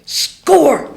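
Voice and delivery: high, loud shout of victory; triumphant shout. Retold or imitated. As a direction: yell it like a dog that just won. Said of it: "My internal dog went".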